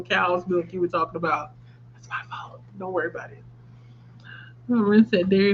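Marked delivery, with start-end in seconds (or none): 1.9-3.4 breathy whispered apology; whispering. Real, not acted. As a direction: whisper it to yourself. Quiet apology after the mix-up.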